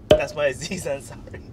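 usual speaking voice

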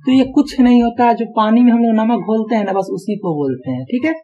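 normal talking voice

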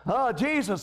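usual speaking voice

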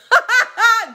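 real high cackle; loud laugh. Real, not acted. After joke about buying.

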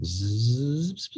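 rising buzz of time speeding up; fast-forward whirr. Acted. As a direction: buzz "zzz" in a long upward slide.

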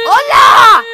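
big loud hola; loud greeting. Real, not acted. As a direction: call out hola.

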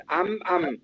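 "am" closed on a hummed M; clear am. Shown as a demonstration. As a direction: end "am" on the lips, not "an". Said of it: "No mamá, sino am". ("am" cerrando en M)

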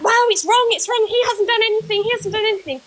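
high, repetitive mock protest; mocking defenders. Mimicking DG's army insisting he is innocent, mock outraged.

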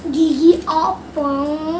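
very slow and drawn out. Shown as a demonstration. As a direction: stretch each syllable slowly. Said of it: "child says word very slowly". (sangat lambat dan panjang)

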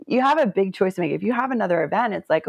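ordinary talk, nothing marked

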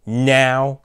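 loud, sharp barked order; mother ordering the girls to bed. A narrator's reading. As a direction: snap the word like a strict mother. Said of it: "Clara barked".

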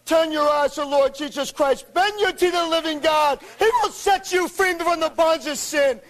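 ordinary talk

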